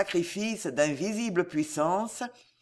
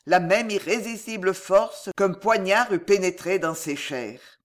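plain reading voice

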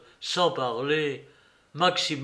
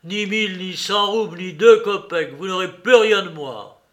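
put-on raised, defiant old father; Fiodor Pavlovitch; 3 semitones higher, 6 dB louder than usual. A narrator's reading. Old father scoffing at the monks, defiant. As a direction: jeer at the monks, louder on the kopecks.

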